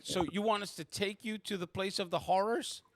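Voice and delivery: high-pitched questioning voice; incredulous Abby. Acted. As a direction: ask it high, in disbelief. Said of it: "She's like".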